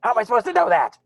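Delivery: high, loud squawking protest; indignant creature. Acted. Mock griffin complains about the well's riddle, indignant squawk.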